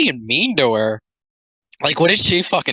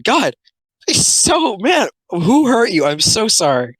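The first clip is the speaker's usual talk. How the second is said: mock shout of pity; 9 semitones higher, slower than usual. Real, not acted. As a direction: wail it up high at the artist.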